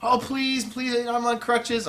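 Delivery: high, slow begging whine; pleading fan. Retold or imitated. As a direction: beg like an injured fan.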